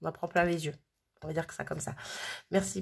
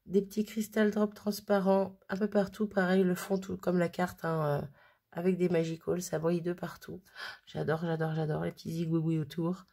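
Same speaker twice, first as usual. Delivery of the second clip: ordinary talk, nothing marked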